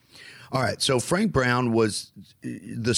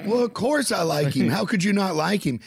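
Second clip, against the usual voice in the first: excited; 8 semitones higher than usual. Retold or imitated. Excited self in story.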